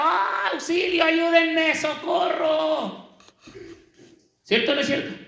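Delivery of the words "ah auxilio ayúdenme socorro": high, drawn-out cry of help; drowning man's cry for help. Retold or imitated. Preacher imitating a drowning man, high drawn-out cry. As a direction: call out like someone going under. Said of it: "pega, grita".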